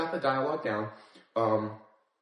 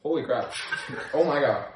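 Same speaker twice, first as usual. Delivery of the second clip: pained exclamation; 3 semitones higher, 5 dB louder than usual. Real, not acted. Reaction to hot dab.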